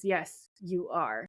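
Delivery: slow, calmer retold reply; measured, drawn-out mock-calm quote. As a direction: say it slowly and evenly, calming things down. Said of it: "and then Dean's calmer".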